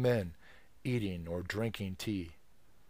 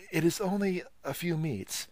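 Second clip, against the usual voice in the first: apologetic; 9 semitones higher than usual. Acted. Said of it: "said Wang Lung apologetically".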